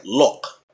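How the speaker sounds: "lock" rounded toward "look"; London-style short rounded o in "lock"; London pronunciation. Shown as a demonstration. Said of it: "if you're over in London".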